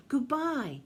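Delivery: storybook farewell call. A narrator's reading. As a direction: call it out to the sky. Calling farewell to departing reindeer.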